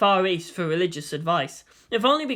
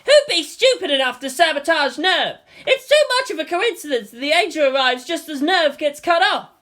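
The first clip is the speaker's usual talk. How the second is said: raised, indignant crowd voice; angry protester; 8 semitones higher, 4 dB louder than usual. Acted. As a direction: accuse loudly.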